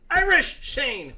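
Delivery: high, loud and drawn out; called-out nickname. Real, not acted. Naming his guitarist friend, slow loud call. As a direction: holler it slowly, up high.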